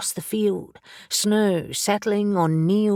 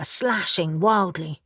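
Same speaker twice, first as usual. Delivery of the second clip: ordinary delivery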